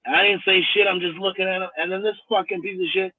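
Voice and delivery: ordinary delivery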